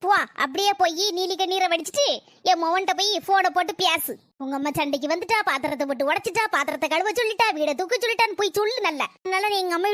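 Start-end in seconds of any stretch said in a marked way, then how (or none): none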